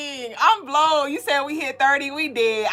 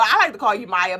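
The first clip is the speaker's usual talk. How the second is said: usual speaking voice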